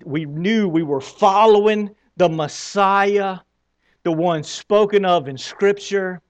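usual speaking voice

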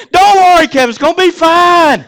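booming high-pitched shout of reassurance; loud God voice. Acted. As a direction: holler comfort at full volume. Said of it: "I wish God would talk so loudly".

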